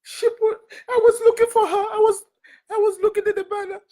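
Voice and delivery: sobbing, voice pitched high; real crying. Real, not acted. Speaker telling listeners her picture was on the banner, crying. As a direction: break down in tears while talking. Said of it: "why I'm crying over that banner".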